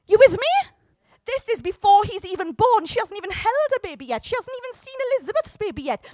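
high, fast, thrilled; excited preaching. Real, not acted. Preacher marvels to the congregation, excited. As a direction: let the wonder spill out.

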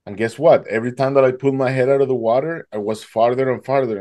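normal talking voice

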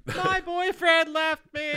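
high, drawn-out fake wail; exaggerated bawling, badly faked; mock crying. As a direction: wail it like a hammed-up breakup. Acting out someone crying.

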